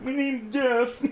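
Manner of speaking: high put-on meme voice; meme quote. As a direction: squeal the meme line. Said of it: "It's like".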